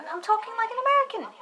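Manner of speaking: very high-pitched silly insistence; mock protest. Child insisting to classmates, high mock protest. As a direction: squeal it in a put-on little voice.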